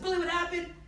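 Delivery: put-on high, urgent young boy's question; alarmed brother. Jimmy finding his brother under the bed, alarmed. Brother asks the fleeing boy.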